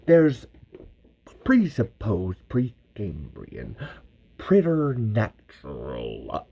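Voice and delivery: very slow, savoring each big word; slow grandiose Bert. Retold or imitated. Host as Bert tries big words, very slow and grand. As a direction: roll out the fancy words like a proclamation. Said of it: "that was actually pretty good".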